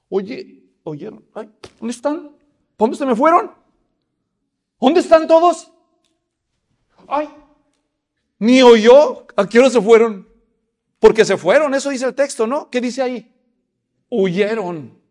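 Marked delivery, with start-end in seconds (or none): 0.1-10.3 high, loud baffled calling out; bewildered calling. Acted. David calling for the soldiers who fled. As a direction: look around for the vanished army. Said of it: "yo creo que dijo".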